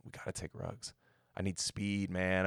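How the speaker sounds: hushed, half-whispered wired coach; imitating Bruce. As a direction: Bruce muttering back to the GM. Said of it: "And Bruce is like".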